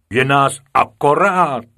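proud report. A narrator's reading. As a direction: announce it with pride. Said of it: "hrdě hlásil učitel".

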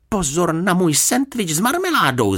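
high-pitched alarmed cry; shouted warning. A narrator's reading. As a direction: call out an urgent warning in a high voice. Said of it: "zakřičel". (vysoký varovný výkřik)

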